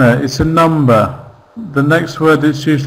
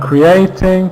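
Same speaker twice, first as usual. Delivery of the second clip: slow "creating" with the middle syllable stressed; 3 semitones higher than usual. Shown as a demonstration. Teacher showing students where creating is stressed, stressed mid-word. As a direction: lean on the "a" in "creating", drawn out. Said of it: "it's stressed in the, in the middle".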